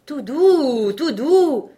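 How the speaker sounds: steady the unicorn with a loud easy call; calming the beast. Acted. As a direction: tailor calling out to tame the charging unicorn. Tailor calms charging unicorn.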